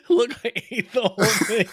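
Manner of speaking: put-on high, laughing toddler voice. Co-host teasing the story, high laughing toddler voice. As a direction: squeak it out like a proud little kid. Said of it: "Like you're a toddler".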